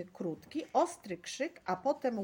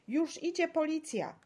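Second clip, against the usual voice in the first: raised, higher-pitched announcement; calling out; 7 semitones higher, 3 dB louder than usual. A narrator's reading. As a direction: call the news to the crowd. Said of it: "zawołała pani dozorczyni".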